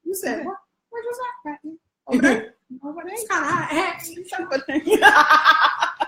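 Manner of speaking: lightly mimicking husband; husband's voice. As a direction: play the husband charmed by her Southern talk. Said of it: "how my husband got me".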